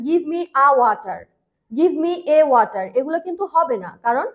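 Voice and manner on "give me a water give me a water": slow wrong example. Shown as a demonstration. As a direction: Give me a water, said slowly as the error. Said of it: "আমরা কিন্তু বলতে পারি না".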